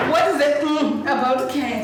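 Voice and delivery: laughing tease. Retold or imitated. Guest teasing the waiter, laughing loudly. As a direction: tease him through a laugh. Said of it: "And I'm like".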